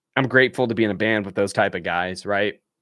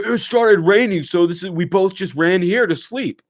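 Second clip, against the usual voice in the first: higher, lightly voiced bandmate excuse; sheepish guitarist; 6 semitones higher, 3 dB louder than usual. Guitarist explains to the narrator why they slept there, higher voiced. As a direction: explain it like it was obvious.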